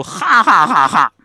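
slow, loud derisive ha-ha-ha; mocking laugh. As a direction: laugh at them with scorn. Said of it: "嘲笑".

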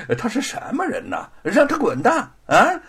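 不耐烦地越说越响、越拔越高; 烦透了，把人赶走; 没好气. Acted. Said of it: "便没好气儿地说". (知府不耐烦地打发差役，越喊越响)

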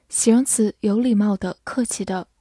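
ordinary talk, nothing marked

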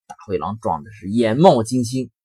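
usual speaking voice

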